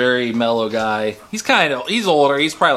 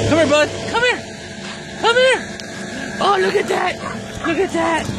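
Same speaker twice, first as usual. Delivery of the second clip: much higher calling voice for the dog; high pet voice. Real, not acted. Owner calling her dog over in a high pet voice.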